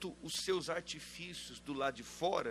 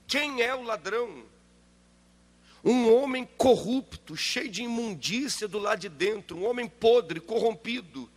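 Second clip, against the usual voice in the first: loud, higher, indignant denunciation; preaching at full force; 5 semitones higher, 7 dB louder than usual. Real, not acted. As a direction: hammer each insult from the pulpit. Preacher's outrage at the thief.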